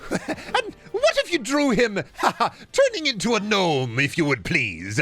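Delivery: laughing. Real, not acted. Laughing request to draw gnome.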